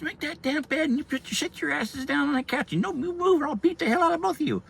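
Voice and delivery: very high, scolding mom voice; hammed-up high-pitched mother laying down threats; imitated angry mom. Mom ordering two boys to make the bed, scolding.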